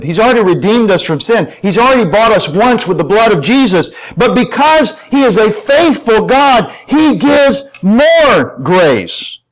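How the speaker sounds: high pulpit voice, slowing at the climax; fervent sermon proclamation; impassioned preaching. Real, not acted. Preaches at full force.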